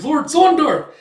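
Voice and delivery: high, loud cry of a name; alarmed shout. Retold or imitated. Soldier crying out to his fallen lord, shrill loud shout. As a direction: scream for your lord. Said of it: "It's like".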